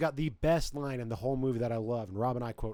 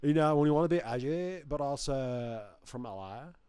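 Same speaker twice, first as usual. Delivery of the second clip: put-on Californian teenager voice. Co-host mocking the edgy kid from L.A., Californian teen voice. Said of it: "teenager voice is just a kid from California".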